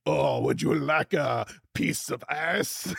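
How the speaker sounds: slow, lightly creepy stranger offering ice; creepy vendor voice. Pretend. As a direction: draw it out slowly like a mildly creepy stranger. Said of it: "the guy says to you".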